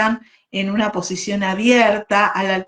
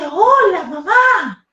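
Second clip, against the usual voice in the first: high, eager little chick; baby bird voice; 10 semitones higher, 5 dB louder, slower than usual. Acted. Baby bird greeting who it thinks is mom, high little voice. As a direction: squeak it out like a tiny newborn bird.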